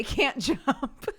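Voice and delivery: slow quote that breaks into a laugh on jump; can't hold it together mid-quote; laughing through it. Retold or imitated. Mother quoting her daughter on slugs, laughing mid-line.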